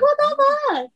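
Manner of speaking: very high-pitched stunned disbelief; astonished. Retold or imitated. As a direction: squeal it out in baffled surprise. Retells reaction to unexpected flowers.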